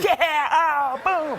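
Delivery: high, laughing burst of excitement; excited Tom Cruise. Retold or imitated. Impersonating a thrilled movie star bursting into the table read, high and laughing.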